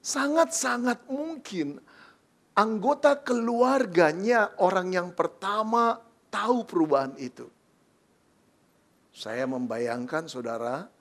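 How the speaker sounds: ordinary delivery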